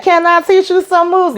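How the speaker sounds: high-pitched movie-quote impression; put-on high voice quoting a film hero; Bruce Leroy. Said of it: "Bruce Leroy when he be like".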